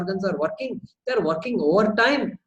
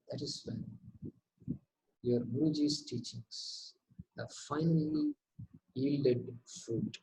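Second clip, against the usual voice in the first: mystical guru talk; 5 semitones lower, 10 dB quieter than usual. Shown as a demonstration. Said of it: "So if I talk like this".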